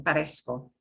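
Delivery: "parezco" with a clear s before "ko"; S sound. Shown as a demonstration. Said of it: "S sound again".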